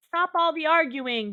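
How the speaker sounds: loud parental order; dad yelling. Acted. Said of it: "Dad yelled".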